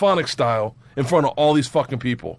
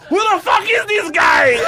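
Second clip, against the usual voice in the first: high, loud incredulous yell; slow, high-pitched shout of disbelief; amazed shout; 6 dB louder, slower than usual. Retold or imitated. Russians reacting to his joke, amazed shout.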